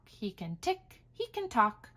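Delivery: ordinary delivery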